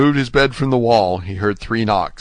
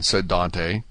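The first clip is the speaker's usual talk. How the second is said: usual speaking voice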